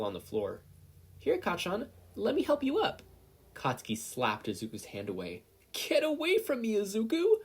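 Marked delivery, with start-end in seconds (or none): 1.2-3.0 much higher, bright cheerful offer; a smiling boy reaching out to his friend; sunny. A narrator's reading. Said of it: "sunshine smile was back". 5.7-7.4 much higher, louder terrified scream; boy recoiling from his friend. A narrator's reading. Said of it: "screaming his head off".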